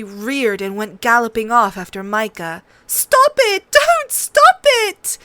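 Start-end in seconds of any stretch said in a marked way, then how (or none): 2.9-5.2 high, loud desperate scream. A narrator's reading. Sansa screams at the fighting children. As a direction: shriek it out in panic. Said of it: "Sansa screamed".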